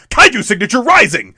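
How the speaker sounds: loud, high action-figure line; gruff tinny action figure. Retold or imitated. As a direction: bark it like a toy commercial. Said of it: "It just sounds like an action figure, like, toy".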